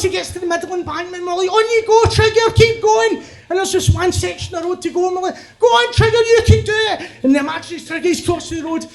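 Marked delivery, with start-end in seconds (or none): 1.5-3.2 urging on. Acted. Friends urging imaginary Trigger across road, high cheering. 5.6-7.0 high-pitched rallying cry; root for him, high and eager; cheering on. Acted. Friends cheering imaginary Trigger over last section, high cheering.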